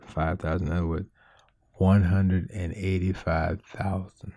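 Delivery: low, hushed scripture reading. Acted. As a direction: read it low, near a whisper.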